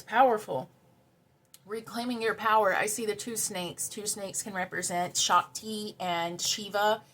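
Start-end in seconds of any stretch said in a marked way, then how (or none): none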